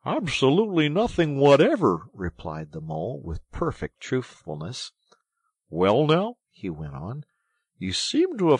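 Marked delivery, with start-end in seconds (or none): 0.0-2.1 loud, high, emphatic denial; blunt retort. A narrator's reading. Mole bluntly deflates rat's triumph. 5.7-6.4 loud, high preamble; huffy. A narrator's reading. Mole begins sarcastic tirade.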